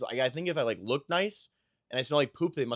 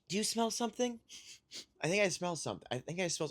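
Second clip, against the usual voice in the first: lightly higher, curious wife asking about a smell; imitating wife sniffing; 6 semitones higher, 5 dB quieter, faster than usual. Husband imitating his wife sniffing the air, higher playful mock voice. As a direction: mimic her suspicious sniffing question. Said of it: "she's kinda just".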